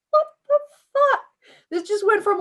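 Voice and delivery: high, loud laughing outburst; laughing disbelief. Real, not acted. Gamer laughing at the game's dark turn, shocked. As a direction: crack up in shock.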